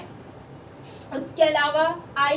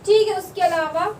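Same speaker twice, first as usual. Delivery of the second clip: usual speaking voice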